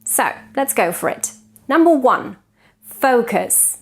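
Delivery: normal talking voice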